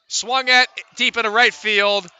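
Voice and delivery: announcer erupting as the ball leaves the park, loud and high; home run call. Real, not acted.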